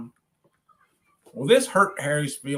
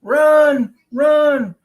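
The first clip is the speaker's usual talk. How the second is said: panicked townsfolk yelling, high and loud; shouting; 8 semitones higher, 8 dB louder, slower than usual. Acted. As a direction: yell the warning at the top of your voice.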